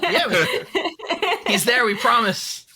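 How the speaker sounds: high, slow laugh through the words. Real, not acted. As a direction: laugh as you reassure them. Laughing about the invisible guest.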